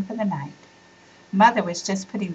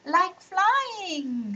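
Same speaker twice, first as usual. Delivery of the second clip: excited shout; 10 semitones higher than usual. Acted. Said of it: "shouted little Anissa".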